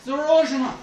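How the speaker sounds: sharp repeated danger shout, imitated; calling a warning. Russian hikers warning him of danger ahead, calling out loudly. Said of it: "like this, when there was danger".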